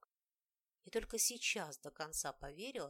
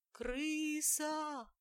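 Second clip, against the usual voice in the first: high shocked cry of Крыса; shocked shriek; 8 semitones higher, 4 dB louder than usual. A narrator's reading. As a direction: blurt it out in fright. Heroine shrieks at the rat.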